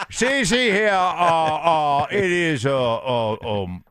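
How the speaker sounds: laughing through a blustery drawl; Southern drawl. Acted. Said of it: "That's better".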